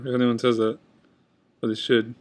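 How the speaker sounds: normal talking voice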